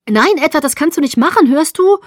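loud, high-pitched objection; agitated protest. A narrator's reading. Bella objects as Edward drives her away, agitated. Acted protest in a car quarrel.

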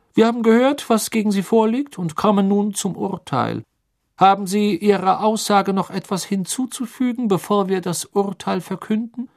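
loud, high official voice; formal stern sentencing; stern judge. Acted. Crouch presides sternly. (strenger Richter)

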